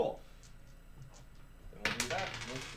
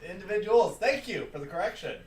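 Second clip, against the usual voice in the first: mock polite; 7 semitones higher, 9 dB louder than usual. Real, not acted. Mock-polite to many-headed rat.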